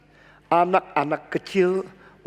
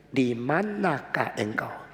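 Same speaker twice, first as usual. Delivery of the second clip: slow reading; 5 semitones lower, 6 dB quieter, slower than usual. Shown as a demonstration. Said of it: "Kira-kira nadanya bagaimana?".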